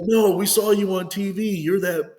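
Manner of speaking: high, loud imitation of eager strangers; excited insistence. Two guys explain they saw him on TV, excitedly imitated. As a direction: insist on it, voice lifted and pushed.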